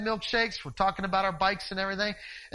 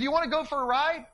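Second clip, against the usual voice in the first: calling out; 7 semitones higher, 4 dB louder than usual. Retold or imitated. Yelling to neighbour.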